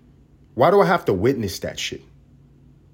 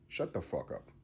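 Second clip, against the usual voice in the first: muttered retort; 5 semitones lower, 19 dB quieter than usual. Real, not acted. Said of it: "own angry retort".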